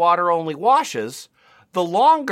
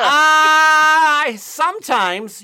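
slow and higher; voice shifts higher; 11 semitones higher, 5 dB louder, much slower than usual. Real, not acted. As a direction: drawl it out higher and slowly. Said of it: "My voice changed".

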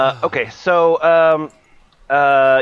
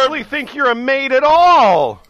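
hammed-up suspicious john, raised and louder; mock suspicious customer; 10 semitones higher, 4 dB louder than usual. Mocking porn plot, suspicious client questioning the maid. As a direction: play the doubting client in a cheesy porn plot.

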